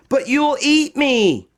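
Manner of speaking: high, small frightened voice; Josephine fearful. Acted. Girl in a tree answering the bear, high and scared. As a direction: fear being eaten. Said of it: "said Josephine".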